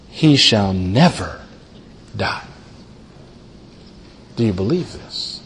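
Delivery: a soft, low, searching question. Acted. Preacher repeats Jesus' words softly.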